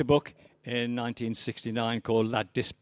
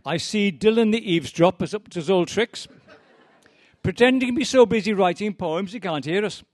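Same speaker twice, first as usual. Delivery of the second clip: higher, louder teasing with a laugh; mocking barman; 8 semitones higher, 6 dB louder than usual. Barman teasing the eavesdropping poet, laughing. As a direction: tease him loud enough for him to hear.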